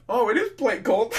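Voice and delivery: high, loud giggle through the words; cracking up at the name; laughing it out. Real, not acted.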